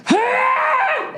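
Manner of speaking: sudden very high yelp of pain; pained scream. Retold or imitated. Chef grabbing a hot plate, high pained yelp. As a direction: imitate a cook burning his hands, short shriek. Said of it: "muffled scream".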